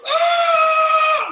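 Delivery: long, high, loud scream; terrified scream. Retold or imitated. Storyteller imitating the boy driving in tears, long scream. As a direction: imitate the crying driver screaming. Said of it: "Like that, right?".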